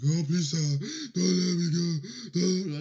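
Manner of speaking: put-on high, loud pleading little boy; whiny begging kid. Crying kid begging the superintendent, high whiny plea. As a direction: beg like a scared crying child.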